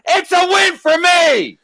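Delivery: high, loud slow shout; mock shout. Mocking Landau's announcement with a shout. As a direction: yell it out as a triumphant mock. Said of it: "Landau with that video".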